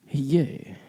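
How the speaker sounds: usual speaking voice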